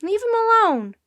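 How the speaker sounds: high, loud protest; full high-pitched shout; yelling. A narrator's reading. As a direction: yell to defend a friend. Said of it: "yelled Jack".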